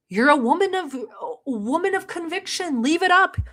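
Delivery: high, loud sing-song taunt; mock taunting. Taunting Chantal about deleting her rant, high mocking sing-song. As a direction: goad her in a mocking baby-talk voice.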